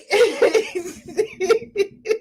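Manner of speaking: long high-pitched giggle; helpless peal of high laughter; laughing. Real, not acted. Streamer amused by hometown street names, high laughing. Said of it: "I'm laughing at that".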